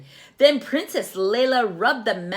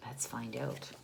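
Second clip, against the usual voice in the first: whisper; 8 semitones lower, 14 dB quieter than usual. A narrator's reading. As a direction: tease the next page softly. Whispered teaser to children.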